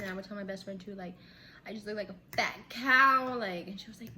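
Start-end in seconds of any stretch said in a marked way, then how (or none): 1.7-3.4 loud punch on "fat cow"; self-mocking complaint. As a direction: complain with a big loud finish. Complaining to her best friend.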